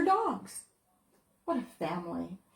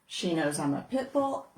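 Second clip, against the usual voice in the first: ordinary delivery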